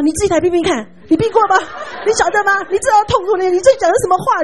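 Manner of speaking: high, loud, bitter scolding. Retold or imitated. Sick woman scolds her visitor for empty comfort, loud and high. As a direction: snap back at the one offering comfort. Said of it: "然后他就在骂我".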